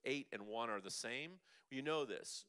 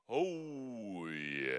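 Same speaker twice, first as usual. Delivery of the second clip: sung vocal release; 6 dB louder than usual. Shown as a demonstration. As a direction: let "Oh, yeah" ride out on the breath, big and falling. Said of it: "Ready? Go".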